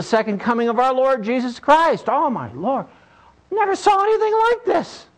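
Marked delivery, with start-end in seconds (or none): 2.1-5.1 put-on amazed old man.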